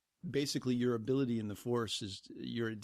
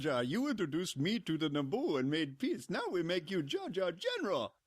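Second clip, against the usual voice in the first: higher hammy alien voice; mock film character; 6 semitones higher than usual. Fan mocking a speech promoting Jar Jar, caricatured voice. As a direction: put on a pompous cartoon creature voice.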